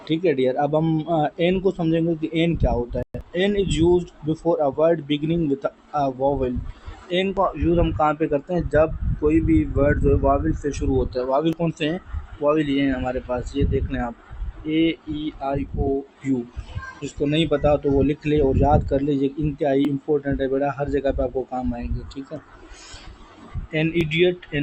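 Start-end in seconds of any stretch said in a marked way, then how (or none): 3.4-6.6 slow, word by word rule reading; slow dictation. Shown as a demonstration. Teacher dictating the rule to students, slowly. 23.6-24.6 each word of uniform on Wednesday drawn out; slow dictation. Shown as a demonstration. Teacher reading the example to students, slowly.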